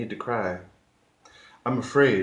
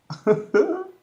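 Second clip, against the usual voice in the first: high-pitched mock sobbing; badly faked wailing; fake cry; 5 dB louder than usual. Acting out a crying grapefruit, fake cry. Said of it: "that's kind of a fake cry".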